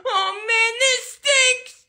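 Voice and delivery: put-on high-pitched kid whine; high, sulky wail; whiny disappointment. Child disappointed, refused a meal.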